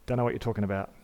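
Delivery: low, soft brush-off; denying Peter. Retold or imitated. Peter quietly denying he knows Jesus, low and soft. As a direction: mutter it like a frightened denial.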